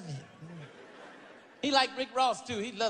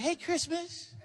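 [name]; 8 semitones higher, slower than usual. Retold or imitated. [name] greeting Chris by new name, high and giggly. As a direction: chirp it sweetly. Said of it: "He was like".

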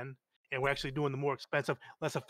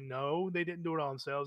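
ordinary talk

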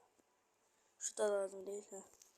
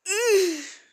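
sharp high frustrated "ugh"; loud exasperated huff; 9 semitones higher, 15 dB louder than usual. Real, not acted. Gamer losing patience with his charger, loud huff. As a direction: blow out a loud fed-up huff.

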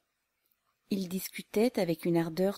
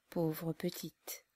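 soft, slow murmur of pity; sad pity; 9 dB quieter, much slower than usual. Acted. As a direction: sigh it out gently, shaking your head. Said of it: "en secouant tristement la tête".

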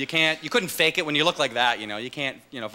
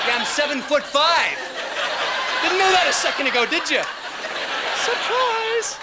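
hammed-up towering tough; 12 semitones higher than usual. To a bully, pretending to be huge, mock menace. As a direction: play the sudden giant, laughing. Acting tall to scare bully.

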